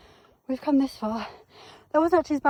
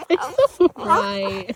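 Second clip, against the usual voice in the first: soothing; 7 semitones higher, 5 dB louder, much slower than usual. Real, not acted. Soothing the angry dog.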